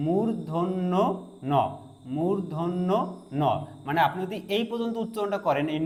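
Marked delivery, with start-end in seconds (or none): 0.1-3.4 মূর্ধন্য slow as mur-dhon-no, pause, then ন; teacher's syllable-by-syllable মূর্ধন্য ন; careful demonstration. Shown as a demonstration. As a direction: split মূর্ধন্য from a separate ন. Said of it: "লেখা আছে উচ্চারণটা".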